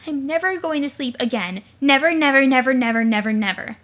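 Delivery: loud, panicked repeating, fading at the end; frantic refusal. Acted. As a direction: hammer each never, then run out of steam. Refusing ever to sleep again.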